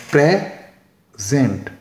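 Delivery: Present split in two, PRE... ZENT; present with a clear gap after PRE; syllable by syllable. Shown as a demonstration. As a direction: slowly break present into two separate syllables. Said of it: "तो इसमें दो syllable हैं".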